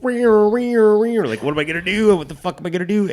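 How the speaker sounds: mock panic, caught off guard. Caught by his wife, mock panicked inner voice.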